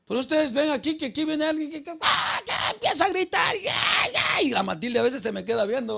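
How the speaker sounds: loud, high shout in the church. Retold or imitated. Preacher mimicking a brother who starts yelling in church, loud and high. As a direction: yell it out, voice cracking high.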